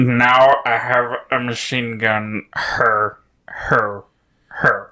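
slow, deliberate villain impression; measured, spaced-out mock laugh syllables; Die Hard villain. Podcaster quoting a Die Hard villain, slow and deliberate. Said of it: "you know, Die Hard".

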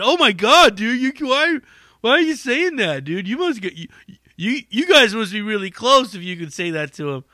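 high, loud, shocked "why are you saying that"; shocked disbelief. Retold or imitated. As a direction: play the stunned staffer, voice jumping up. Shocked staff member quoted.

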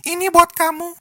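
put-on high sweet girlfriend voice; high, sugary girlish tone; cute voice. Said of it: "Dengan suara imutnya".

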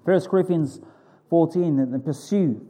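ordinary talk

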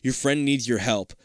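normal talking voice

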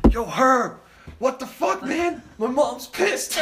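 high-pitched, exasperated hiss through the door; high annoyed friend. Retold or imitated. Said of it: "Atul's knocking".

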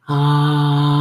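long, steady "ah" tone held on one note; sustained "ah". Shown as a demonstration. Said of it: "sounding out the R sound".

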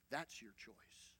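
slow, hushed whisper. Acted. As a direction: whisper it slowly to the congregation. Repeated line, first take whispered.

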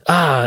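mock hurt yelp; pained groan. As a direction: shot driver groaning in pain. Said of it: "And he's like".

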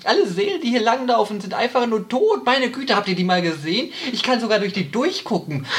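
high, amused sphinx mocking the dead souls. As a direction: lift the pitch, playful and teasing. GM voices the Sphinx.

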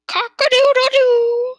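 loud, high, drawn-out crow; squawking cockerel. A narrator's reading. Cockerel shooting up in the air, squawking. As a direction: crow it out with a long held ending. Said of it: "squawked the cockerel".

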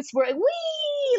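very high squealed wee. Retold or imitated. Dad swerving the car for the kids, high squeal. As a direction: squeal it like a car ride thrill. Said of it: "He would do swerving".

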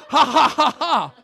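loud, high, booming-out ha-ha; mock divine laughter. The Trinity laughing at each other, loud mock laughter. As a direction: laugh heartily at full volume, acted. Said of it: "the Trinity going".

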